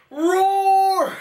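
high, loud playful roar; mock roar. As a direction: roar like a pet dinosaur. Imitating pet dinosaur roar.

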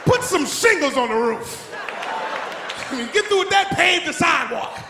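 a father yelling out Saturday chores. Retold or imitated. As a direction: bark orders at the kids.

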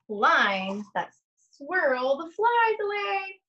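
put-on slow, sing-song read-aloud to young children; lingering on each rhyme, long pauses between pages; story read aloud. Reads picture book aloud slowly.

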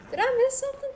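high startled question. Real, not acted. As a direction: ask it thrown off. Confused in game.

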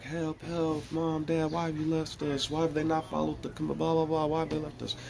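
put-on high, soft pleading child voices; children crying out from the wilderness, high and soft. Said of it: "I hear all these children like".

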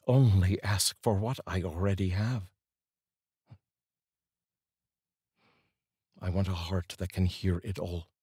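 slow, earnest reading of a modern prayer; prayer poem reading. Acted. Reads a prayer poem aloud.

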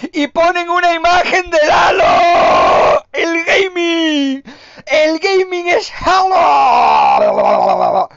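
very high, slow, loud mock screaming; mocking shout. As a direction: screech it out in outrage. Mocking the Halo image at the awards. (grito burlón muy agudo)

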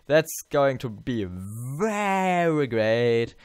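oversell it, meaning the opposite; sarcastic. Pretend. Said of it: "not really".

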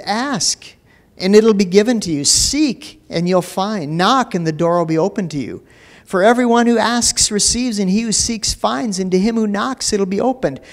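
high, ringing scripture proclamation; preaching at full force. Acted. Preacher proclaiming the verse to his church, full force. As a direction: lift the verses up to the congregation.

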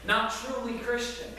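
ordinary talk, nothing marked

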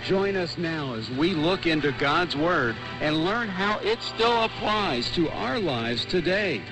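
ordinary talk, nothing marked

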